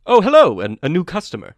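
loud, high surprised hello, then settling; grandly notice a new customer; genteel goblin shopkeeper's welcome; regal goblin, greeting. Acted. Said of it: "he speaks with such, like, regality".

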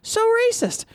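high drawn-out mock gasp; scandalized, rising to a squeal; mock shock. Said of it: "And I was like".

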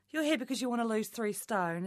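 ordinary delivery

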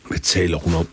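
soft, understated wonder; puzzled. A narrator's reading. Valentin wonders at Isabella's words, quietly puzzled. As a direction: ask under your voice, mildly baffled. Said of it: "spurgte Valentin forundret".

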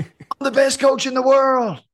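very high, loud and slow, booming it; imitated proclamation. Coach booming his claim down the phone, high and slow.

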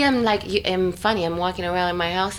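ordinary delivery

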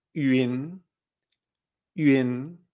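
"yun" as ü-in, lifting at the end; second tone. Shown as a demonstration. Teacher giving Chinese for cloud, rising "yun" as ü-in. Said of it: "segundo tono".

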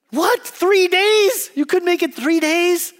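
very high-pitched, loud disbelief; mock incredulous. Viewer reacts to contestant quitting early, hammed-up incredulity. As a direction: squeal it in scornful astonishment.

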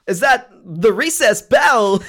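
high, loud, drawn-out impression; slow, halting, hammed-up celebrity voice; Christopher Walken. Kid asking at school, in a hammed-up Walken. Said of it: "like, a Christopher Walken accent".

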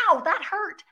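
high squeaky monkey voice. Retold or imitated. Monkey squealing at the keeper who yanked its hair. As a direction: squeak it like a hurt little monkey. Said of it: "the monkey would turn around and go".

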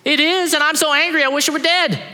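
high, loud petulant whine; mock tantrum from Jonah; sulky Jonah. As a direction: play Jonah as a sulking brat. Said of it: "And he goes".

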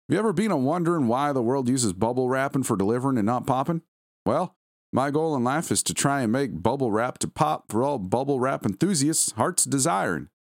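high, singsong salesman voice; Ned Flanders. Acted. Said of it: "sounded like it was written by Ned Flanders".

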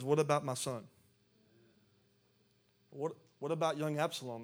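loud, urgent demand. Retold or imitated. King David asks after his son, demanding. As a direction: demand an answer, voice pushed up. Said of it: "the king demanded".

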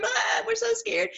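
high, whimpering mock fear; a hint of hammed-up fear; mock scared disciples. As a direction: play the frightened disciples, half joking. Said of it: "and they're scared".

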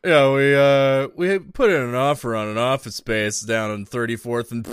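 lightly voiced bourgeois bore, starting loud; a hint of snooty business small talk; bourgeois impression. Podcaster mocking girlfriend's family dinner chat, light bourgeois impression. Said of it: "Bourgeois Impression".

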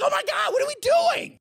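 high, loud fed-up cry; exasperated shout. Retold or imitated. Reacting to the movie getting lame again, exasperated high shout. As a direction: throw up your hands and yell it.

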